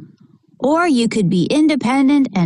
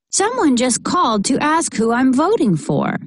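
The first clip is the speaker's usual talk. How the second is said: ordinary delivery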